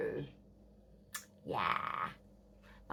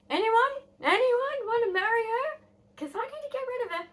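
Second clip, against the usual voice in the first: high-pitched mock mum touting her daughter; very high, exaggerated matchmaking plea; imitated pushy mum; 6 dB louder than usual. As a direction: call out to all comers in a high mum voice. Mum hawking daughter for marriage.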